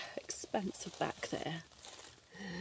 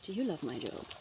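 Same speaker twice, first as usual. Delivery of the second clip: ordinary talk, nothing marked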